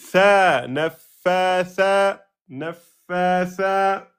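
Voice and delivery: "naffatha" very slow, both final vowels long; prolongation. Shown as a demonstration. Teacher showing the second prolongation, "naffatha" drawn out. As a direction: stretch "Tha", then chant "naffatha" slowly. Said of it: "Ici aussi".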